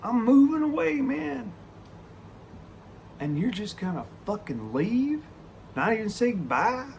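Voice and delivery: high-pitched, incredulous protest; pissed off. Retold or imitated. Man moving away, buddy leaving without goodbye, indignant protest. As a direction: retell it as wounded, rising indignation. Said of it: "I was pissed".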